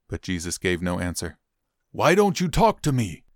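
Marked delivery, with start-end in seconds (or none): 1.9-3.2 high, sharp demanding question. Acted. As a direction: press the silent prisoner for an answer. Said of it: "Pilate demanded".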